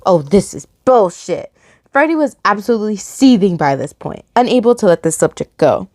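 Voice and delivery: loud, projected read-aloud; story narration. A narrator's reading. Narrator opening a chapter for listeners, projected story reading.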